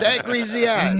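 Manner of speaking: high, loud call-out; mother's greeting shout. Retold or imitated. Mother greeting her son's friend, a loud high call. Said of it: "He was just".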